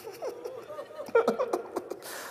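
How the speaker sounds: a high, breaking laugh-sob; high emotional laugh. Real, not acted. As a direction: let the laugh crack out. (جذباتی ہنسی)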